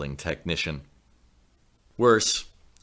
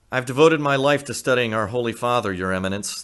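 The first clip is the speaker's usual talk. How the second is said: higher and louder, pleading earnestness; eager devoted plea, raised and loud; painfully earnest; 7 semitones higher, 7 dB louder than usual. A narrator's reading. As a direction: insist with painful sincerity. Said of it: "painfully earnest".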